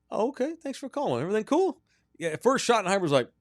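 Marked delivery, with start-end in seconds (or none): 0.1-1.8 high-pitched, mock polite confusion; much higher, questioning put-on voice; puzzled coach imitation. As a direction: play a baffled coach answering the phone way up high. Acts out the coach's puzzled reply.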